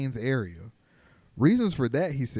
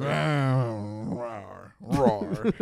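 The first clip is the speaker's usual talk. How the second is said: bear roar. Retold or imitated. Imitating bear.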